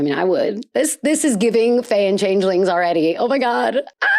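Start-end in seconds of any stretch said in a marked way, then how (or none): none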